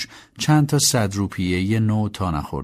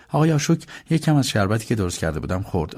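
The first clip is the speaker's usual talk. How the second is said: usual speaking voice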